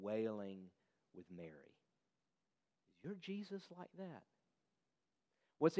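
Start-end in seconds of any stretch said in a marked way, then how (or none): 1.1-4.2 hushed. Real, not acted. Deep grieving with Mary.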